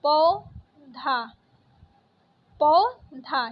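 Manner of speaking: पौधा split as "pau... dha", very slow; syllable by syllable. Shown as a demonstration. Teacher showing the vowel sign, पौधा slowly split in syllables. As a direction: draw out पौधा in two separate syllables. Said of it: "प में ओ की मात्रा".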